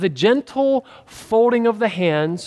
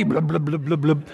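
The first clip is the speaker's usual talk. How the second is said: low gurgling cartoon sinking noise; cartoon blub underwater; 4 semitones lower than usual. Preacher imitating a child sinking in the pool, cartoon gurgles. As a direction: bubble sounds like a cartoon going under. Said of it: "like one of those cartoons".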